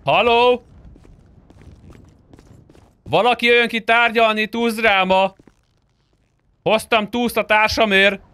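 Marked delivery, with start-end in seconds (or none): none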